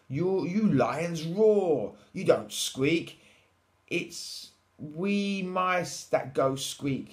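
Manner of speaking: high, loud, slow explaining; little mouse. A narrator's reading. Mouse explaining to the lion, slow high mouse voice. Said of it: "she said".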